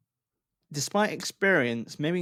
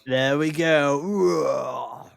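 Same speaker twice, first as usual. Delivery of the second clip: slow, drawn-out louder dragon growl; dragon impression; 6 dB louder, much slower than usual. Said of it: "That's my dragon impression".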